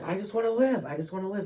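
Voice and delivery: high, loud pleading cry, a mock scream; retold desperate shout to the nurses. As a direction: beg to live at the top of your voice. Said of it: "And I'm screaming".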